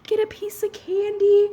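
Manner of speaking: put-on high, drawn-out pleading kid voice; begging child. Little kid begging mom for candy, high wheedling plea. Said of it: "used to beg your mom when you were little".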